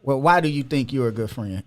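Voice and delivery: ordinary talk, nothing marked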